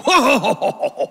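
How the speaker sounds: knowing laugh. Acted. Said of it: "And they go".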